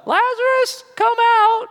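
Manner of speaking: very high, thin squeal of a summons; squeaky feeble call. Shown as a demonstration. Preacher mocks how Jesus didn't call Lazarus, in a high squeal. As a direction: call it out in a silly high falsetto. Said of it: "I doubt it was a".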